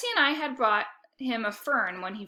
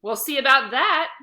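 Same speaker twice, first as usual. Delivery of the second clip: louder mock growl, higher; growl back; 4 semitones higher, 8 dB louder than usual. A narrator's reading. Boy growling back at his sister. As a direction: answer her challenge. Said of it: "I growled right back".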